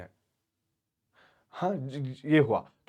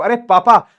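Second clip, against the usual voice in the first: higher, louder call; 7 semitones higher, 9 dB louder, slower than usual. Retold or imitated. Natalia calling her father for help, higher and louder. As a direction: call out to papa for backup.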